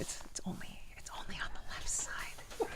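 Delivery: soft unvoiced whisper. Real, not acted. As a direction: whisper it close to the mic. Testing one side of headphones.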